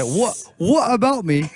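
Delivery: put-on British accent with a laugh at the end; stagey British, tickled by it. Shown as a demonstration. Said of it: "in a British accent".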